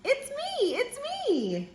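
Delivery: very high singsong little voice; duck character. A narrator's reading. Duck revealing who is under the mask, high squeaky singsong. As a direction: pipe it up high, drawing out each "me". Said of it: "says the duck".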